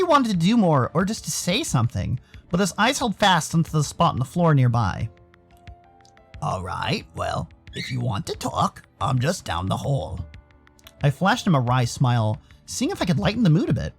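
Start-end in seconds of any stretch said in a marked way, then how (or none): 6.4-10.2 low, soft offer to talk; low, quiet, reassuring buddy; deep-voiced caring friend.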